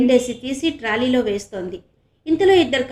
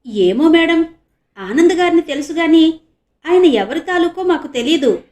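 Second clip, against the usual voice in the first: puzzled, higher and louder; confused; 5 semitones higher, 8 dB louder than usual. A narrator's reading. Constables confused about the reporter's family. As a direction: sound baffled and unsure. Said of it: "అయోమయంగా చూస్తూ అన్నారిద్దరు".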